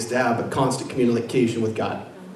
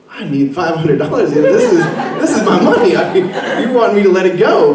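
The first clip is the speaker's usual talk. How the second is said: indignant inner protest; 8 semitones higher, 7 dB louder than usual. Retold or imitated. Retold inner protest about money.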